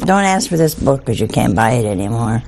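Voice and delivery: usual speaking voice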